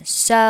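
so drawn out full, rounding into its u sound. Shown as a demonstration. Teacher showing a full so, drawn out. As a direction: give so a full rounded vowel. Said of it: "把前面这个so读得饱满一点".

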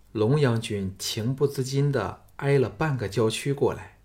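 ordinary delivery, nothing marked

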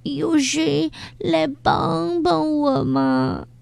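high, slow, lonely plea for help; pleading call. Acted. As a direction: call out weakly, hoping someone hears. Huahua calling for help alone.

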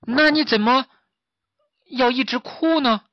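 high-pitched piglet question; curious piglet. Acted. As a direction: press the tiger for a reason, pausing midway. 小猪好奇追问老虎. (小猪好奇地高声问)

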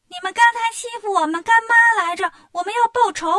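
high, loud indignant bug voice; angry little insects. Acted. Insects loudly vowing revenge for their godmother, high and indignant. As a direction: puff up like a tiny furious bug.

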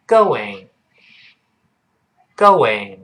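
slow "going" as go-wing; drawn-out model of "going", lips rounding into w; linking w demo. Shown as a demonstration. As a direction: glide through a w inside "going". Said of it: "中间要人为的加上一个辅音".

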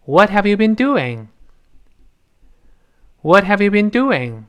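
"What have you been doing" linked, "doing" high then falling; emphasis at end. Shown as a demonstration. Students repeat this question after teacher, "doing" emphasised, falling. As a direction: lift "doing" and let it fall at the end. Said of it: "最后强调".

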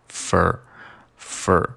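"fur" drawn slowly with a curled r; weak form of "for". Shown as a demonstration. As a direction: reduce the vowel to "fur". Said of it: "会被弱读成fur". (弱读卷舌音)

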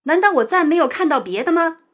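high, quick indignant protest; offended hen, rapid rising challenge; indignant retort. A narrator's reading. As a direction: fire back, offended. Offended hen keeps arguing back.

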